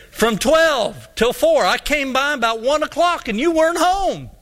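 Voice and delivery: high, aggrieved mock complaint; retold indignant insistence. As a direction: spell it out as an exasperated man, acted. Junior explains indignantly.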